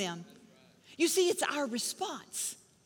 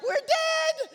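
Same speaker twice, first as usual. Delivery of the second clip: high, loud panicked cry; panicked shout; 4 dB louder than usual. Retold or imitated. Mom on a plane when the oxygen masks drop, screaming in panic. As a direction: blurt it out like the plane is going down. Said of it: "And I just panicked".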